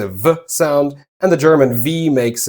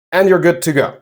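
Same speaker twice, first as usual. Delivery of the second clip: ordinary talk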